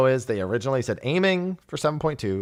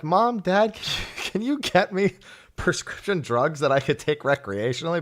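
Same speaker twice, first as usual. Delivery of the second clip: higher-pitched mock request to parents; pleading kid act; 6 semitones higher than usual. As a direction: act out a tentative ask to Mom and Dad. Voices himself asking parents for drugs.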